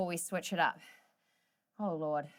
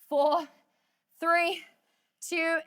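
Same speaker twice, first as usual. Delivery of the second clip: loud rising count, each number punched; shouted countdown; 7 semitones higher, 4 dB louder, much slower than usual. Real, not acted. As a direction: call the count out loud to push them.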